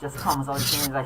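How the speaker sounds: ordinary talk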